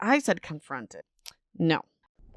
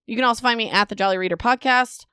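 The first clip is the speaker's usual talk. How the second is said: normal talking voice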